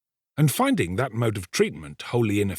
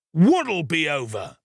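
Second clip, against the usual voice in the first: slow savage demand, pitched up; 7 semitones higher, 4 dB louder, slower than usual. A narrator's reading. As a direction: demand it as a fierce ruffian. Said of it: "in a savage voice".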